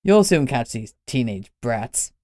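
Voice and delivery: put-on pleased old man; satisfied. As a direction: savour it. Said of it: "he said with satisfaction".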